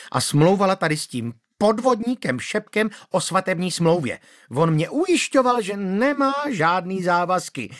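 high, shrill, outraged scolding; shrill angry widow. Acted. Manda scolding the magistrate shrilly. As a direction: play the wronged widow screeching.

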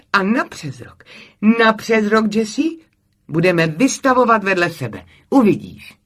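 high, emphatic enthusiasm; eager promise. A narrator's reading. Camille promises Jessie they will exhibit together, excited and emphatic. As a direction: promise it loudly, with rising excitement.

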